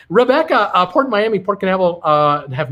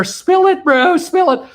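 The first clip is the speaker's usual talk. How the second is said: eager urging; 12 semitones higher, 5 dB louder, slower than usual. Retold or imitated. Reading a viewer's eager comment.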